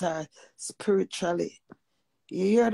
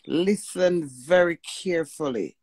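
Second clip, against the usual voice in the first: ordinary talk, nothing marked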